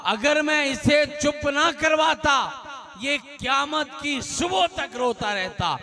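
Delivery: high, ringing declaration; proclaiming the Imam's words. Retold or imitated. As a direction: cry it out to the gathered companions. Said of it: "कायनात के इमाम फरमाते".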